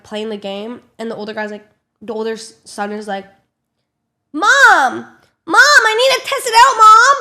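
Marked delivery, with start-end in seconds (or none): 4.3-7.2 mock kid yelling for mom, high and loud; high-pitched bratty shout; whiny demanding kid. Older son pestering his mom for the iPad, demanding yell.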